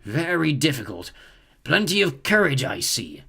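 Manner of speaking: lightly voiced pondering hat; musing hat. A narrator's reading. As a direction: weigh up the boy slowly, thinking aloud. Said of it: "a small voice in his ear".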